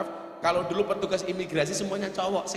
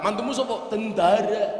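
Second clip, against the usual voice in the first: higher-pitched imitation of a boastful in-law; put-on, raised-pitch swagger; mock showing off; 4 semitones higher than usual. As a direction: play the proud parent-in-law. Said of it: "gayanya minta ampun".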